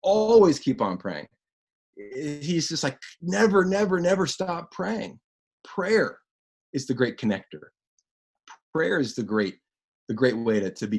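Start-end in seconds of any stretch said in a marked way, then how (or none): none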